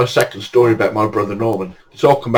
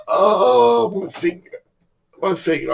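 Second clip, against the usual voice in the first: mock moaning; 9 semitones higher, slower than usual. Victor nursing his finger in the car, mock moan. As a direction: imitate a self-pitying whimper.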